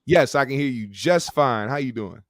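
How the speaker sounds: ordinary delivery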